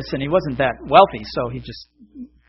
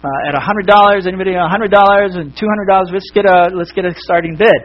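lively auctioneer opening bids, louder and higher; 4 semitones higher, 6 dB louder than usual. Acted. Auctioneer calls the crowd to bid on the picture, projecting. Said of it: "auctioneer gets up on the platform".

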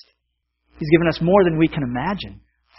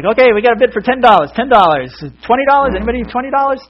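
loud, high, rapid bid-calling; auctioneer; 9 semitones higher, 7 dB louder, faster than usual. Acted. Auctioneer takes the bid and asks for more, loud patter. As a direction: rattle off bids like an auctioneer. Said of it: "he's like".

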